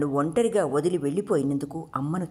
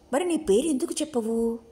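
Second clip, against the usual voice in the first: lightly voiced girl, pitched higher; coaxing girl; 8 semitones higher than usual. A narrator's reading. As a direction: ask in a higher, coaxing girl's voice. Narrator voices girl coaxing for name.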